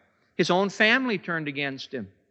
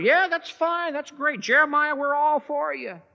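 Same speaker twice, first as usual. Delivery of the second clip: mock cheerful approval; 13 semitones higher than usual. Lukewarm believers cheering Jeremiah on.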